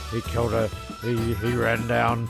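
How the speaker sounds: ordinary talk, nothing marked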